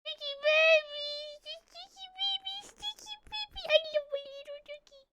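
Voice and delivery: very high, squeaky cooing. Real, not acted. Owner fussing over a little dog, high squeaky baby talk. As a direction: coo sweet nonsense in a tiny high voice.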